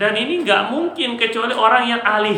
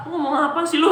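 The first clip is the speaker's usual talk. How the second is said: mock unclear speaker; 9 semitones higher than usual. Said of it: "itu nggak jelas itu".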